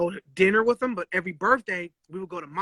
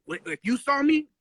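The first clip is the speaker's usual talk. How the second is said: ordinary talk, nothing marked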